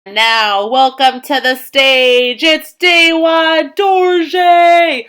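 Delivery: loud, drawn-out stage announcer. Acted. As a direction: hype up the crowd as you introduce.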